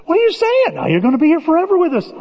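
high, fast imitation of a scoffer; mock incredulous voice. Pastor imitating someone promising eternity here, high and mocking. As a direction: play the disbelieving friend, hammed-up.

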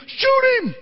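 high shouted urging at the screen; frantic high shout at a movie; yelling at the screen. Retold or imitated. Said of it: "And I'm thinking".

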